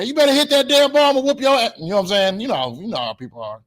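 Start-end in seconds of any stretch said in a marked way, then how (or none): 0.0-1.7 high, loud mock threat; yelling parent. Parent in the stands threatening the child at bat, yelling. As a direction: holler a warning from the stands. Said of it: "they heard you yelling at 'em".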